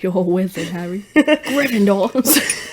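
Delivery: soft Harry Potter British, drawn out at the end; mock British wizard-film line; tipsy, giggly British impression; British accent. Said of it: "turns into Harry Potter".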